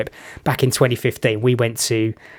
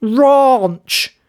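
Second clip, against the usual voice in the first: much higher and louder, a blurted British "Ranch!"; blurting "Ranch!" loudly to a Memphis waiter; 12 semitones higher, 5 dB louder than usual. Retold or imitated. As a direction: shout "Ranch!" in a broad British voice. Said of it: "I said it so loudly and so British".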